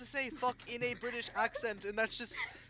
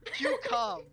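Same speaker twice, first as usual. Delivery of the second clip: tasting the word; 9 semitones higher, 3 dB louder than usual. Shown as a demonstration. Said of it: "I love it".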